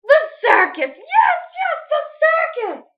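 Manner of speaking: high, loud thrilled cry; excited witch. Acted. Witch Grinch cheering her own idea, high and loud. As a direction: squeal with delight at the idea.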